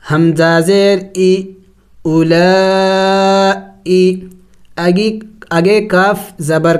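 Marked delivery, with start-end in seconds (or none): 2.0-4.2 اولائی with the لا held very long and slow; long madd. Shown as a demonstration. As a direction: stretch the middle of اولائی well out. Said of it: "ابھی خوب زیادہ لمبا کریں گے". (اولائی میں مد کو خوب لمبا کرنا)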